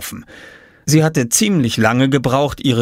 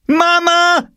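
very high loud scream; 6 dB louder than usual. A narrator's reading. Jule screaming for Mama, outraged. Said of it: "schrie Jule".

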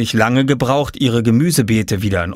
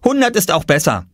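put-on higher boyish child voice; Oskar's voice; 9 semitones higher than usual. A narrator's reading. Narrator voicing young Oskar, proud. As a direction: play the little boy, pleased with himself.

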